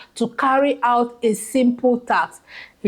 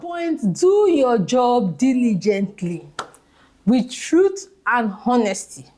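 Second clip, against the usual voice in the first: ordinary talk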